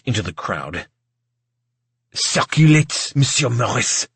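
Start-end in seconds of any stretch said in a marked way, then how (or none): 2.1-4.1 put-on hissed French accent. A narrator's reading. Maitre d' hisses instruction in waiter's ear, French accent. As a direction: hiss an order in a thick French accent. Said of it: "he hissed the instruction in my ear".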